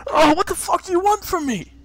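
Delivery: high, loud and tearful; whimpering auctioneer. Acted. Bleeding auctioneer begs the party, high and tearful. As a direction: cry it out, bleeding and scared. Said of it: "he goes".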